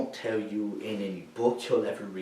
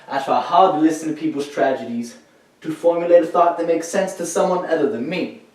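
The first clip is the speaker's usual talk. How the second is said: insistent; 5 semitones higher, 6 dB louder than usual. Acted. Troubled veteran insists on his effort.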